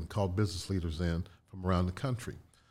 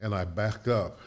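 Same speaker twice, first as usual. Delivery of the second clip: ordinary delivery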